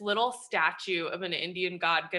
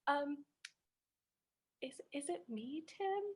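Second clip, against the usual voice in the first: tiny, much softer, higher timid question; terrified; 4 semitones higher, 13 dB quieter than usual. Retold or imitated. Young actress timidly asks the star if she is the problem, hushed and scared. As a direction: barely dare to ask. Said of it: "so terrified".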